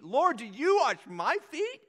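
high, loud, slow disbelief; astonished question. Retold or imitated. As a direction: ask it in shock. Peter's shocked question retold.

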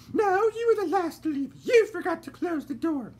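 a genteel lady fretting in the dark; voiced lady character; fussy lady. A narrator's reading. Lady Dandelion retorts.

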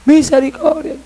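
mock scream. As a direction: imitate a damned man crying for mercy. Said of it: "y él gritando".

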